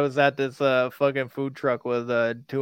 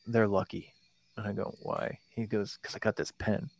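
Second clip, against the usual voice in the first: softer, low muttered replay; hushed aside; 3 semitones lower, 10 dB quieter than usual. Retold or imitated. Friend T J nudging him at the food truck, hushed. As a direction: lean in and say it quietly.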